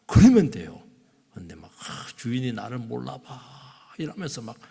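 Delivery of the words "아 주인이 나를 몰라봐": resentful muttering. Retold or imitated. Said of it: "분통 터뜨리며".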